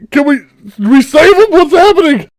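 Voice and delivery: loud high "can we save him"; desperate plea. Acted. Loud high desperate plea. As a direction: Harvick begging to save the boy. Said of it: "he's like".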